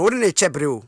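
usual speaking voice

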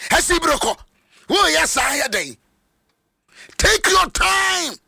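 high, forceful sermon shouting; fiery Twi preacher voice; preaching at full force. Acted.

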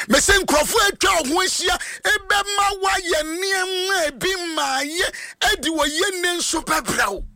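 fired-up sermon shout; full-force preaching. Acted. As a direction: preach it out to the whole church.

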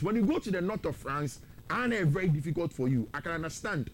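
ordinary delivery

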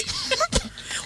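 high, breathless burst of laughter. Real, not acted. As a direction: laugh out in a squeal. Caller laughing hard.

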